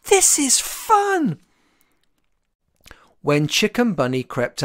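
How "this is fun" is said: high, slow, gleeful call from afar; stretched-out happy call, much higher. A narrator's reading. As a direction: sing it out with delight. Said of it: "a voice called".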